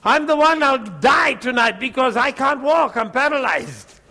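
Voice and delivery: high, loud desperate plea, easing off at the end; imitated scream for help. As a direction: act out a panicked cry for rescue. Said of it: "This dear Sister Naira screams out".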